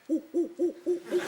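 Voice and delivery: high hooting ape calls; monkey noises. Acted. Comic playing the monkey, high hoots. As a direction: hoot like a chimp. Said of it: "And the monkey went".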